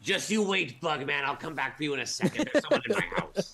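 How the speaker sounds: taunting dragon. Acted. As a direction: sneer like a cocky dragon. Monster taunts in Draconic.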